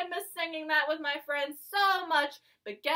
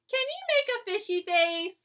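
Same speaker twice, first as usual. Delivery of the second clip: ordinary talk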